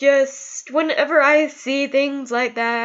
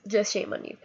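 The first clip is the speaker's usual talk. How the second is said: much softer, almost to herself; quiet repeat; 3 semitones lower, 9 dB quieter than usual. Real, not acted. Scolding fighters at Relay for Life, dropping to a soft repeat. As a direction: let it drop low and soft.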